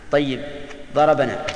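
normal talking voice

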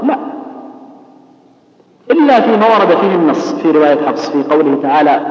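normal talking voice

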